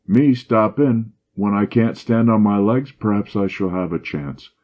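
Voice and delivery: usual speaking voice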